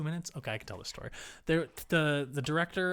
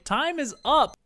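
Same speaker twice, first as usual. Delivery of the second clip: ordinary talk, nothing marked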